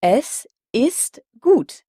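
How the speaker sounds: "Es ist gut" slowly, the T of gut plainly audible; slow clear German. Shown as a demonstration. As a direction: repeat "Es ist gut" as a slow model, crisp T. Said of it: "Encore une fois".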